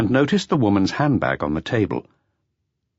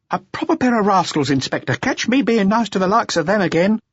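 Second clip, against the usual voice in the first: higher, resentful woman's voice, slowing at the end; indignant dancer; 14 semitones higher than usual. A narrator's reading. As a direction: spit out the grievance in her voice. Adele complaining to chief.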